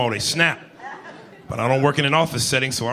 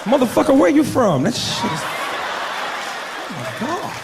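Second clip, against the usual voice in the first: thrilled woman; 9 semitones higher than usual. Acted. Woman charmed by man's accent, high excited exclamation.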